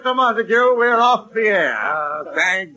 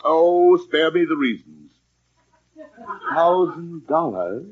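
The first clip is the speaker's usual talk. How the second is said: ordinary delivery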